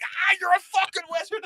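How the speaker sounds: very high mock shriek of amazement; freaked-out shriek. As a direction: squeal it like you've seen magic. Retelling freaking out at watch.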